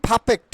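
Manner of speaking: "perfect" said PHA-phekt, r dropped; breathy first p in "perfect", as pha-phekt; corrected pronunciation. Shown as a demonstration. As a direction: say "perfect" with puffed p and no r. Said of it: "it will be".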